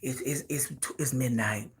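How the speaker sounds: low, soft imitation of a man texting; low mock flirty voice. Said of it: "sending text messages talking about".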